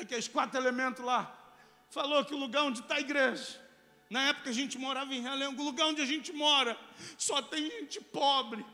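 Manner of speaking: loud, high rant building in force; indignant outburst. Retold or imitated. Husband reporting the insults to his wife, loud indignant rant. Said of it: "no meio da minha indignação".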